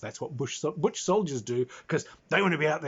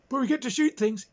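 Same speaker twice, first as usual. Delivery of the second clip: high, louder sulky protest; mock whiny player; 11 semitones higher, 5 dB louder than usual. Player protesting they still get to shoot, high and sulky. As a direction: defend it like a sulking kid. Players defend their playstyle.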